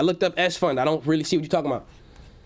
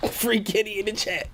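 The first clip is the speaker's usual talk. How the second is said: laughing; 4 semitones higher than usual. Real, not acted. Reads the comment while laughing.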